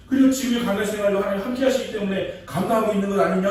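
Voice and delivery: rally a frightened friend with building fervour; Jonathan encouraging. Acted. Jonathan reminding hiding David of God's grace, fervent rallying voice.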